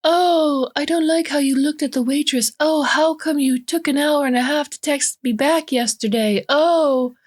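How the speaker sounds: high, whiny-mimicry falsetto; mock them in a falsetto voice; exaggerated falsetto taunt. A narrator's reading. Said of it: "His voice takes on a mocking falsetto".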